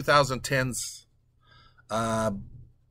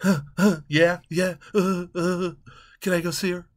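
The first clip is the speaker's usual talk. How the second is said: mocking Matt Damon; 3 semitones higher than usual. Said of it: "his stupid".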